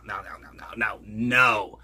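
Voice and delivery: normal talking voice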